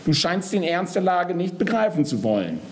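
high, contained angry reproach; roaring. A narrator's reading. Death warning Geist about his serious situation, contained roar. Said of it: "brüllt er".

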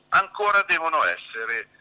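ordinary delivery

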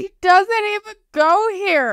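high, drawn-out movie-quote cry; mock outburst. Podcaster blurting a movie quote at someone, mock outburst.

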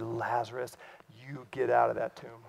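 soft, low, firm call to Lazarus; quiet command. Acted. Preacher retelling Jesus calling Lazarus, quiet firm command. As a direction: hush it like a private order.

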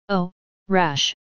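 lightly mocking echo of the word; a hint of scorn, gently voiced. A narrator's reading. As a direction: tease his caution with a light scoff. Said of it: "Her voice mocked at my prudence".

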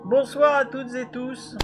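slow greeting muffled by a mask; behind welding mask. Real, not acted. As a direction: greet everyone through a mask, slowly. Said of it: "la voix étouffée avec ce masque".

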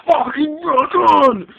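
slow, high, loud cheer; excited yell. Real, not acted. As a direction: belt it out like a rocker. Hyped over new hair color.

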